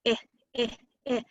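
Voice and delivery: "eh" repeated as three brief separate vowels; short I sound. Shown as a demonstration. Teacher modelling the vowel of in, short repeated "eh". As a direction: isolate "eh" in short bursts, pausing between. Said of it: "It's the short I sound".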